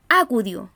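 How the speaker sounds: acudió misstressed as Á-cudio, first syllable punched; stress on first syllable. Shown as a demonstration. Teacher showing how we don't say acudió, first-syllable stress. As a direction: hit the A of acudió, let the rest drop. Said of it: "Nosotros no decimos".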